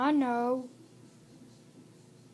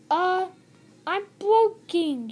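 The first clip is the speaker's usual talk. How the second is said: raised, lightly acted storybook voice; yelling; 4 semitones higher, 4 dB louder than usual. A narrator's reading. Friend finds Birdie broken down, mildly yelling. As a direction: call it out a bit higher and louder.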